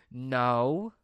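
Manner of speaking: loud, stretched no rising at the end; drawn-out denial. Retold or imitated. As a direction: deny it with exaggerated innocence. Retold denial of faking sickness.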